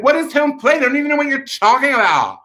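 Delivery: high, quick mock-frantic protest; exasperated retort. Clueless kid answering the screaming coach, exasperated retort. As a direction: rattle it off high in baffled exasperation.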